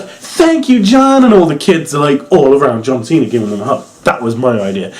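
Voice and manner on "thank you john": put-on much higher, like a grateful little kid; high child voice. Vlogger voicing a freed child thanking John Cena. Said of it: "the kid's like".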